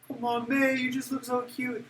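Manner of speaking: very high, affectionate coo. Real, not acted. Teacher coaxing May to look angry, high cooing. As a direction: gush over a little one, high-pitched.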